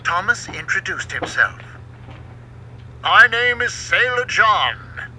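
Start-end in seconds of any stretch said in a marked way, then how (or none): 3.0-5.1 Sailor John. A narrator's reading. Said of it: "the man said".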